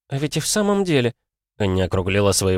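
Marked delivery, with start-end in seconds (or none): 0.1-1.1 put-on young girl voice. Girl's voice, realizing.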